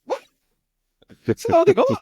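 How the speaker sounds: loud, very high-pitched disbelief; incredulous outburst. Real, not acted. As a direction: shriek the question in astonishment. Astonished at the low correct answer.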